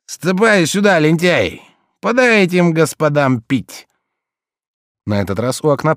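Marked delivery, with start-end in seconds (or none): 0.0-3.9 put-on drunk shout. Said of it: "Пьяный как стелька".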